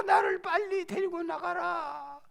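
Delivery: desperate plea. Acted. Wounded king cries out.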